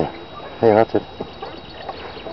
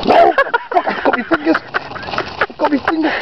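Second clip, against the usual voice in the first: high panicked yelp, voice trembling; real shock as the bird grabs her fingers; shaking with fright; 13 semitones higher, slower than usual. Real, not acted. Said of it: "You're shaking".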